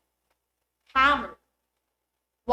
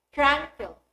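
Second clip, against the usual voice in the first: TRANquil, TRAN punched louder; stress on first syllable; 2 semitones higher, 5 dB louder than usual. Shown as a demonstration. Teacher giving the base word, TRANquil stressed at the front. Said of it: "Let's have this as examples".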